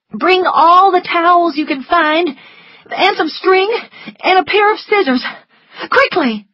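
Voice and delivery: urgent orders. A narrator's reading. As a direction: rush her with orders. Scarlet ordering Prissy.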